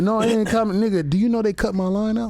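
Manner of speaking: high, worked-up disbelief; comic outrage. Real, not acted. Comic telling the host his line got cut, exasperated. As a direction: protest it like a wronged man.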